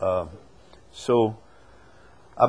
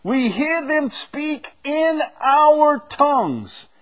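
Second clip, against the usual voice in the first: slow, high declaiming of the Bible verse; scripture reading; 14 semitones higher, 4 dB louder, slower than usual. Acted. Preacher reading Acts aloud to the group, slow high declaiming. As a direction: proclaim each nation's name slowly.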